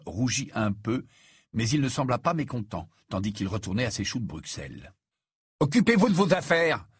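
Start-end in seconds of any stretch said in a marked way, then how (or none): 5.6-6.9 higher-pitched, indignant exclamation; irritated retort. A narrator's reading. Ron rebuffs his brothers' questions, irritated. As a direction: shut down the teasing, annoyed.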